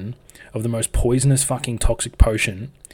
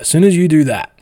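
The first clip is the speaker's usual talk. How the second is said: even more forceful repeat; 7 semitones higher, 12 dB louder than usual. Real, not acted. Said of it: "And as soon as you do that".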